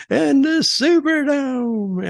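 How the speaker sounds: high, slow, dragged-out mock announcement; confused Hogan imitation. Mimicking Hogan's muddled promo from the back row, high and slow. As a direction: stretch it out high like a lost wrestler. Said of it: "I'm going".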